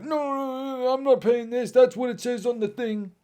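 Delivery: high, loud, drawn-out complaint; mock a customer kicking up a fuss; protesting customer. Acts out customers refusing to pay.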